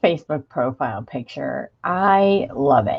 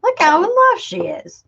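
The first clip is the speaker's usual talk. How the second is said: much higher, gushing, slow and drawn-out squeal; 14 semitones higher than usual. Retold or imitated. Host gushing over a viewer's profile picture in a high squeal. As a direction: coo over the photo like a smitten fan. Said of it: "I was like".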